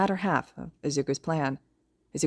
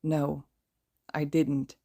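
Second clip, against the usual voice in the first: voice cracking; much slower than usual. A narrator's reading. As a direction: let the voice crack. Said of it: "Dabi's voice cracks around the words".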